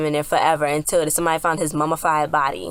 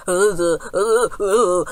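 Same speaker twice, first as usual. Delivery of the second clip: wordless trance-like stuttered grunts, slightly higher; mock psychic spazzing; 3 semitones higher, much slower than usual. Psychic playing along to freak out friends, mock spazzing. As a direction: imitate a psychic having a fit. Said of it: "she was really spazzing".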